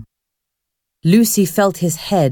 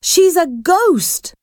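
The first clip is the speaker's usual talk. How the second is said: high, slow, alarmed exclamation; alarmed realisation; 11 semitones higher, slower than usual. A narrator's reading. Girl tells boy who the strange girl is, alarmed. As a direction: draw it out high in dawning alarm.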